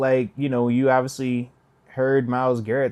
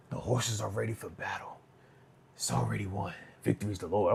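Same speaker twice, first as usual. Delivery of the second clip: quoting Jameis; 6 semitones lower, 10 dB quieter than usual. Retold or imitated. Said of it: "That dude said".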